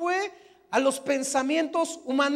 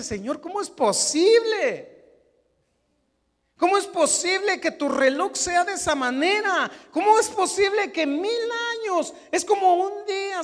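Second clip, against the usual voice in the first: incredulous asking; 6 semitones higher than usual. Retold or imitated. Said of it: "decía".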